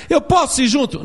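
put-on high, hopeful little kid voice; child asking. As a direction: ask it like a small child hoping for yes.

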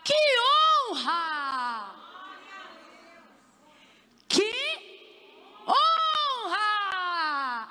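drawn-out, wailing exclamation; hammed-up awe, stretched and falling; overcome greeting cry. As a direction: cry it out with long held vowels. Isabel hails Maria, acted.

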